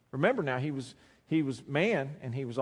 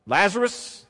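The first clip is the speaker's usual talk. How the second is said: loud, raised call to someone far away; ringing, high-pitched summons; crying out; 7 semitones higher, 9 dB louder, slower than usual. Retold or imitated. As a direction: call the dead man out by name. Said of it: "he cried out".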